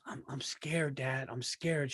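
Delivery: put-on quietly, like a nervous nine-year-old; scared little daughter. Father retelling daughter before tryouts, soft and scared. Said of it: "she goes".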